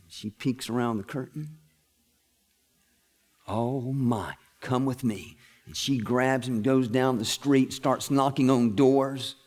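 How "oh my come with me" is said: hushed amazement. Acted. Stunned wife sees him healed.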